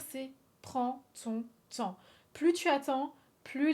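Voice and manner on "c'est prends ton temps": "prends ton temps" drawn out, slow and weighty; slow, deliberate "prends ton temps"; slow emphatic advice. Real, not acted. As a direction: lay it down slowly, word by word. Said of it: "Tout ce que j'ai à dire".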